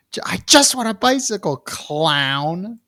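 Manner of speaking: very high, thin child imitation; mock yelling kid. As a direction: mimic the kid in a tiny high voice. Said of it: "why are you yelling at me?".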